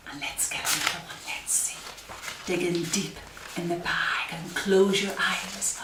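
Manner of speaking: usual speaking voice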